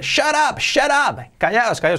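high-pitched mock shout, no extra volume; acted yelling. Homer silencing everyone who is shouting, high mock yell. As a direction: call it out high, cutting through the others. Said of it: "cuando están ya todos gritando".

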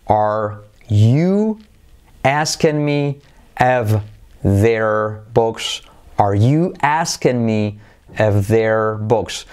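drawn-out, word-by-word English; careful teacher's demonstration, very slow; slow model pronunciation. Shown as a demonstration. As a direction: sound out each word for learners. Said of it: "qué complicación tiene a nivel de pronunciación".

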